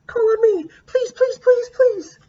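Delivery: put-on very high, pleading child voice; desperate to be called on; eager begging. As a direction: beg the teacher to pick you. Eager student begs teacher.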